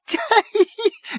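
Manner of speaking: very high, breathless laughing fit; convulsed laughter. A narrator's reading. Prack in front of Arthur, convulsing with laughter. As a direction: lose it completely. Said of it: "convulsed with fresh paroxysms of laughter".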